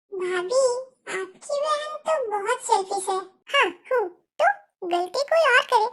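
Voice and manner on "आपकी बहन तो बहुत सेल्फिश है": normal talking voice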